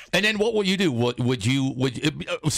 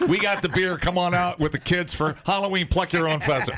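higher, hammed-up promoter; mock ad pitch; 6 semitones higher than usual. Host pitching pheasant plucking to families, mock ad pitch. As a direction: sell it like a corny local event ad. Improvising a mock brewery advertisement.

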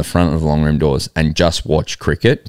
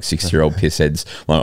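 ordinary talk

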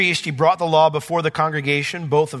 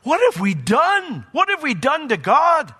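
higher, slow, pleading lament of repentant people; anguished cry; 11 semitones higher than usual. Acted. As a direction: cry it out as if breaking over your sins. People weeping over their sins.

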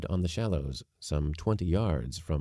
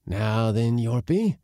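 excited uncle; 5 semitones higher, 7 dB louder than usual. Acted.

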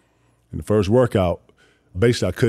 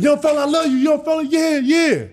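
much higher, louder doting voice; high loud mock fan voice; imitating George; 5 dB louder than usual. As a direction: gush like a proud old man. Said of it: "George was like".

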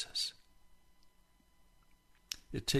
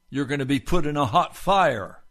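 loud preaching; 5 semitones higher, 10 dB louder than usual. Acted. Preaching burst.

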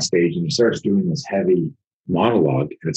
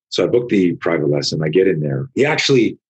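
ordinary delivery

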